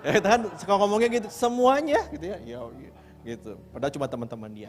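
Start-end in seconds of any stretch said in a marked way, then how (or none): 1.3-2.0 mock exaggeration. Mimicking kids' exaggeration.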